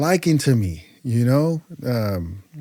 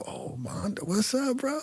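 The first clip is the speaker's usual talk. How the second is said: hushed start leaping up high; retold reunion greeting, breath then high pitch; surprised greeting; 10 semitones higher, 12 dB quieter than usual. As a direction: greet an old friend in soft amazement.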